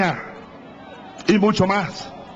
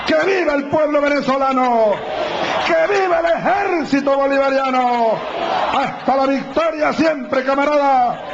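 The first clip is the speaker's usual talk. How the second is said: ranting dictator speech; 5 semitones higher than usual. Real, not acted. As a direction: roar the slogans to the crowd. Closing rally cries to crowd. (discurso exaltado a gritos)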